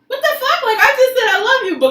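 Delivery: high, incredulous outburst; outraged disbelief. Real, not acted. Complaining to friends that nobody takes her seriously, incredulous. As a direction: pitch it up in disbelief. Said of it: "outraged at being laughed at".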